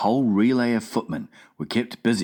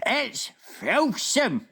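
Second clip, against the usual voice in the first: slow, higher disgusted giant outcry; cried out; slower than usual. Acted. Giant hating the coffee, disgusted outcry. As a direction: cry out in disgust. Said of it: "the B F G cried out".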